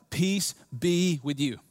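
"Peace be with you" word by word; slow, weighted quote. Acted. Jesus greeting frightened disciples, slow and deliberate. Reads words to be underlined.

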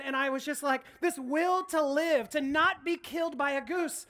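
ordinary delivery

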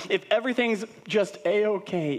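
a high, teasing "just A-okay"; mock carefree. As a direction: lilt it like nothing could go wrong. Mocking carefree attitude.

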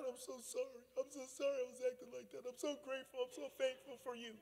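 imitated weeping prayer, high and soft; tearful apology. Man on the bathroom floor apologizing to God, mock tearful apology. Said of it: "just wept and apologized to".

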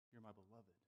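very soft and low; tender, hushed. Acted. Preacher voicing the Father to a listener, tender and hushed. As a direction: say it as a quiet, intimate assurance.

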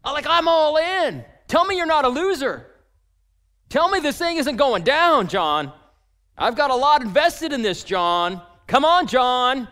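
high, loud, insistent pleading; pleading with John. Retold or imitated. Disciple pressuring John, loud and pleading. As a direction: corner John and beg him loudly. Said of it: "he's like".

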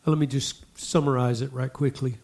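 normal talking voice

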